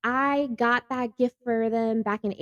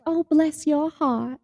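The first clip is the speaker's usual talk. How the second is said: mock sweet; 8 semitones higher, slower than usual. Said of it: "it's like".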